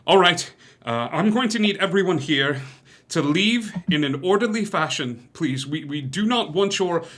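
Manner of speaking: lightly voiced town guard; guard's orders. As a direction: give orders to the crowd. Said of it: "he puts a hand on his sword and he goes".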